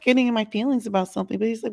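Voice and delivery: normal talking voice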